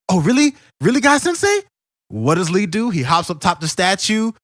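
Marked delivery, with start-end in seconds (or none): 0.1-1.6 much higher and louder, thrilled disbelief; excited Lee. Retold or imitated. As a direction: squeal it like an eager student. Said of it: "And Lee goes".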